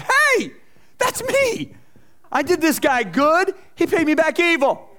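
high, loud indignant cry of recognition; surprised outcry. Retold or imitated. Preacher reading a psalm, crying out that it's him. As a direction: cry out as if the verse names you. Preacher acting out his outburst.

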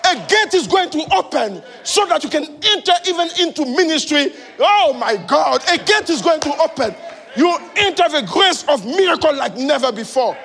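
loud, high, rousing preacher's declaration; impassioned preaching. Real, not acted. Preacher promising the congregation an open gate, fired-up preaching. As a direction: preach it out at full force.

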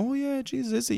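high sarcastic mock surprise. Mocking a colleague's ancestry claim, sarcastic surprise. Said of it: "I was like".

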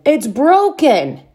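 high, slow alarmed exclaim. A narrator's reading. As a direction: blurt out the bad news. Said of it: "he exclaimed".

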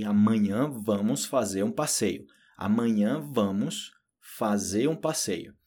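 slow, careful repetition for learners; measured language-teacher demonstration; pronunciation model. Shown as a demonstration. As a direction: draw each phrase out so it can be copied. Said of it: "já sabe como é que é a pronúncia".

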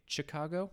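Chicago starting with ch as in chair; hard CH at the start of Chicago; Spanish-speaker pronunciation. Retold or imitated. Quoting how Spanish speakers say the city, Chicago as chi-KAH-go. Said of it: "Spanish speakers that I would hear".

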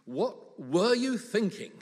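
loud, slow, high-pitched telling-off; scolding mum. Retold or imitated. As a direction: punch "were" high and drag it out like a cross parent. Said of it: "My mum used to say things".

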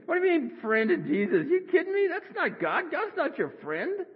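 high-pitched scandalized scoff; mock outrage at a heresy; indignant disbelief. Young churchgoer hearing the song, indignant disbelief. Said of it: "To me, that was blasphemy".

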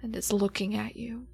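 game master scene setting. Acted. GM sets the opening scene.

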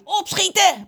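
high, loud squawk; screaming crow. A narrator's reading. Crow keeps hurrying the others, screaming. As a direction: screech the order like a crow. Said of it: "blijft schreeuwen".

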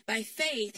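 FAITH punched loud and falling; stress on faith. Acted. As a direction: lean hard on FAITH. Repeated refrain, louder.

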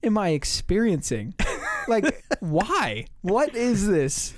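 acted-out bewildered questioning; amused disbelief. Driver laughing to himself after leaving, incredulous. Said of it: "then I start laughing to myself".

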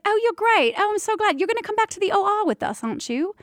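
high, eager London English; chummy, enthusiastic put-on British voice; British accent. London anesthesiologist inviting doula back to the OR, British accent. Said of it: "He was from London".

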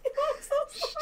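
high squeal through giggles; real helpless laughing sorry; laughing apology. Real, not acted. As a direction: squeeze out an apology while cracking up. Apologising again amid laughter.

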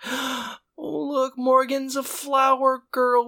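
hammed-up dreamy high voice; gushing sentimental Sean. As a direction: coo it like a lovestruck fool. Mocking Sean going sentimental.

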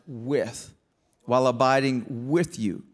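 "with" set apart and leaned on; slow down to land on "with"; stress on WITH. Shown as a demonstration. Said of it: "hear him emphasize".

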